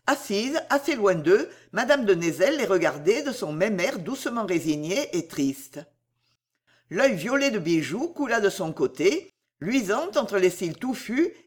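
usual speaking voice

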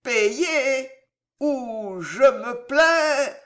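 very slow slurred threat; drunk Gillot. Acted. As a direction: drag each syllable out drunkenly. Drunk nephew threatens uncle.

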